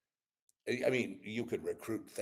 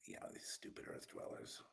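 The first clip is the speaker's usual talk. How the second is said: whispered; 6 semitones lower, 10 dB quieter than usual. Retold or imitated. Contemptuous aside about earth dwellers.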